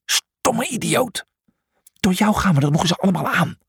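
high, strained scolding; angry. A narrator's reading. Julius blaming Brutus for dooming them all, angrily. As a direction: drag out the insult, then rush the rebuke. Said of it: "keek toen kwaad naar Brutus".

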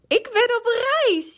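hoge, langgerekte juichkreet; juichend. A narrator's reading. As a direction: juich het hoog en langzaam uit. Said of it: "juichte Kikker". (uitgelaten juichend, hoog)

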